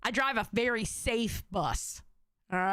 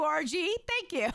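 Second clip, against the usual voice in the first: usual speaking voice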